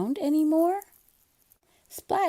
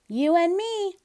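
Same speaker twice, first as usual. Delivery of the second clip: bright, higher cat voice full of cheer; Splat's voice; 9 semitones higher, 4 dB louder than usual. Acted. Splat telling his best friend, cheerful. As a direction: lift it up, eager and playful.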